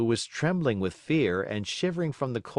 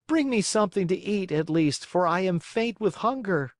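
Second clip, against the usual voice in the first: raised pleading puppet voice; pleading boy; 9 semitones higher than usual. Acted. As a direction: beg through the cold. Pinocchio begs for food.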